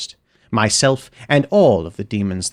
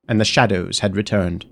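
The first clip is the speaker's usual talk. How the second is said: plain reading voice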